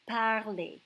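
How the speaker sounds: parler said the French way, par-LAY; French pronunciation. Shown as a demonstration. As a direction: say parler slowly as a model. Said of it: "to speak".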